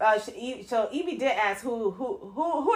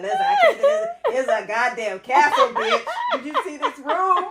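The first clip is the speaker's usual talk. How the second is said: excited high bragging; 6 semitones higher, 4 dB louder than usual. Retold or imitated. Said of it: "She's like".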